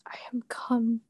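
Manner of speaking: hushed breathy whisper. Acted. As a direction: whisper it through held anger. Unvoiced whisper.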